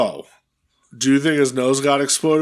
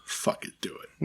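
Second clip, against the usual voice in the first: whisper; 15 dB quieter than usual. Real, not acted. Egging him on.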